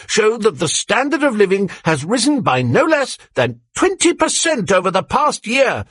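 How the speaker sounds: rally the comrades with good news; bright propaganda announcer calling out; eager youthful announcer. A narrator's reading. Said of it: "came an eager, youthful voice".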